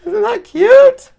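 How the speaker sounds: very high, slow, loud coo; delighted gushing. Real, not acted. Crafter showing viewers her foiled card, delighted. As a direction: squeal it, stretching the words out.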